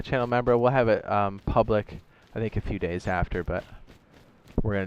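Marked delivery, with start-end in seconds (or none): none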